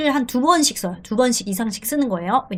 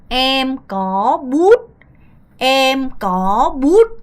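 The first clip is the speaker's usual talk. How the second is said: very slow drawn-out "Em có bút", each syllable held; 3 dB louder, much slower than usual. Shown as a demonstration. Teacher drilling students, slow and drawn out. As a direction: spell out "Em có bút" slowly for learners. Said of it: "조금 더 박자라면".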